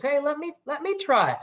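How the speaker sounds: high-pitched, eager little critter; squeaky muskrat voice. Acted. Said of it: "this little squeaky voice".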